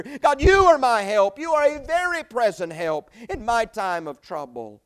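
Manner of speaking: preacher urges the congregation to call on God; full-force preaching. Acted. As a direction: build to a soaring declaration of faith. Preaching climax.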